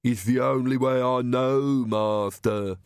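slow, thick-tongued henchman; Igor. A narrator's reading. Coachman excusing his speech to master, henchman voice. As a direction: drag it out as a servile minion. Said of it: "said Igor".

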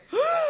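high, mimicked shocked gasp; startled gasp. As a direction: gasp like the yanked patient. Imitates patient's shock at being pulled.

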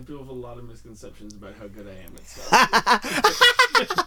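laughing. Real, not acted. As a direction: laugh through the line. Self-mocking boast, laughing.

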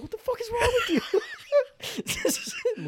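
very high squeal, laughing through it; mock shocked squeal. Cousins pulling him off, mock shocked squeal. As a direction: imitate horrified onlookers in a squeaky-high voice.

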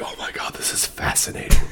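awed whisper. Retold or imitated. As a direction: breathe it out in wonder. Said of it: "I was like".

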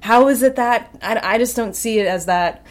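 ordinary delivery

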